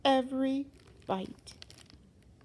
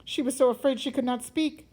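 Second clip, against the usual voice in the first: plain reading voice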